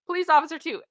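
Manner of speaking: ordinary talk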